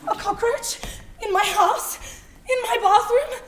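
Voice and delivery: loud, high, half-laughing panic; hysterical disbelief. Acted. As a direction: lose it over a single bug. Panic over the cockroach.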